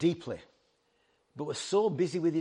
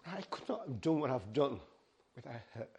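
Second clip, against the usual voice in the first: getting emotional. Real, not acted. Preacher tearing up while praising his wife's support. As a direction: voice softening with real emotion, dropping to near nothing. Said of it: "And I'm getting emotional".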